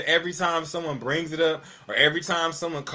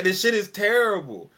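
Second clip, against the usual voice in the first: ordinary talk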